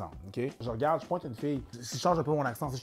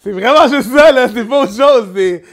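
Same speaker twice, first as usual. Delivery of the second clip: high, loud, through laughter; barely contain the laughter while insisting; laughing hard; 7 semitones higher, 16 dB louder than usual. Real, not acted. Comedian explaining his own money joke, laughing hard.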